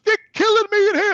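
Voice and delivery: put-on high, frantic old mother; mother panicking on the phone, high and urgent; panicked mother. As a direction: cry out like a scared mom on the phone.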